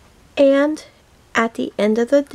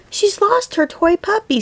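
put-on child voice; 10 semitones higher than usual. Child voice high.

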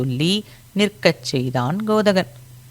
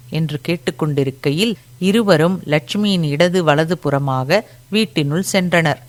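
usual speaking voice

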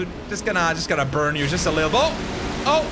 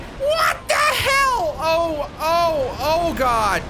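high, slow, stretched disbelief; stunned shriek; 9 semitones higher, much slower than usual. Real, not acted. Shocked at monster.

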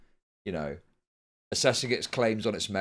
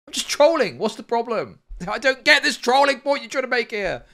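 much higher and louder, sarcastic parody; mocking troll voice; 10 semitones higher, 5 dB louder than usual. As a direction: mimic a smug troll making excuses.

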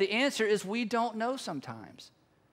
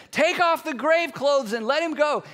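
loud command; 5 semitones higher, 5 dB louder than usual. Acted. Acted command of Jesus to onlookers.

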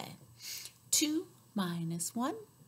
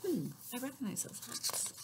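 ordinary delivery, nothing marked

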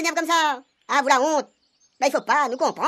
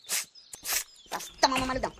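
far off; 7 dB quieter, much slower than usual. Acted. Muffled far-off slow line.

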